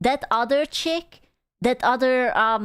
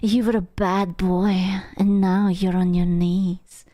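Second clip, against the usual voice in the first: ASMR role-play; 2 semitones lower, slower than usual. Retold or imitated. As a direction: imitate a slow-burn ASMR role-play, partly whispered. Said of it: "She's role-playing".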